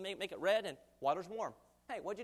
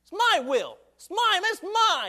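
high, loud stubborn protest; proud insisting; 6 semitones higher, 11 dB louder, slower than usual. Retold or imitated. Proud people shouting at God that their life is theirs. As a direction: insist like a proud person clinging to his life. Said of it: "many times we say".

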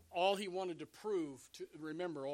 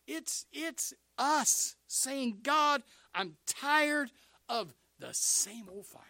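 slow, weighty, raised-pitch prayer; impassioned plea; 10 semitones higher, 8 dB louder, much slower than usual. Acted. Congregation telling God they are tired, slow impassioned plea. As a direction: draw each word out with force.

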